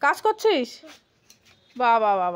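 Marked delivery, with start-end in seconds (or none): 1.8-2.3 mock "wah wah" with a dry falling tune; lower, sarcastic bravo; sarcastic praise. Real, not acted. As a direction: praise it so it sounds like a put-down. Mother mocks child's "work.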